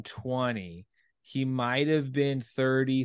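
usual speaking voice

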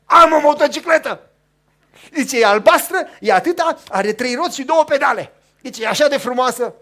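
loud, thrilled boasting; little boy bragging to neighbourhood kids, loud and thrilled; excited kid bragging. Retold or imitated. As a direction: shout the news to all the kids. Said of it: "i-a strâns pe toți și făcea".

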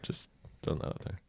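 quick unvoiced whisper. Real, not acted. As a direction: toss it off in a whisper. Aside after a silly remark.

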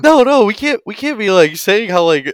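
high, loud pleading objection; flustered protest. Acted. Counselor tries to stop the celebrating, flustered and high. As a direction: plead with a rowdy group to stop.

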